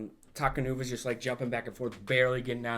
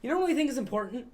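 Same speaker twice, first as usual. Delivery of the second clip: excited emphasis; 13 semitones higher, 4 dB louder, faster than usual. Real, not acted. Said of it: "excited aside".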